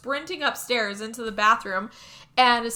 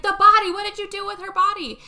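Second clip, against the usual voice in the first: frantic demand; 10 semitones higher, 8 dB louder than usual. Retold or imitated. Husband demanding answers.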